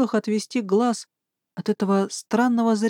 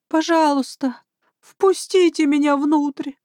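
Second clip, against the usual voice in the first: desperate pleading; 7 semitones higher than usual. Acted. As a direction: beg as if your life depends on it. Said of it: "его голос был полон отчаяния".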